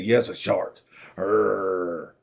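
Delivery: low growling "arr"; low pirate growl. Retold or imitated.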